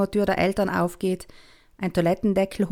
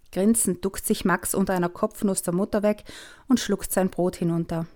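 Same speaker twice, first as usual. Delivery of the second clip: usual speaking voice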